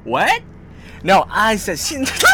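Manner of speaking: high, loud and slow gyopo protest; exasperated Korean American accent. Pretend. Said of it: "계속해주세요".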